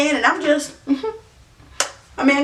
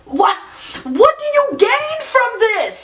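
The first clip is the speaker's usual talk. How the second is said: exasperated; 9 semitones higher, slower than usual. Acted. Stand-up punchline, exasperated question.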